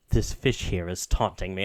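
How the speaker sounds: low brooding god. Retold or imitated. Hades echoing the player, low and deadpan. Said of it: "Hades just echoes".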